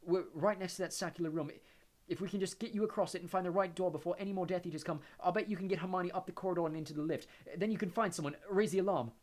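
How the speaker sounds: tense and urgent; urgent Harry. Acted. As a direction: keep it urgent, pressing on.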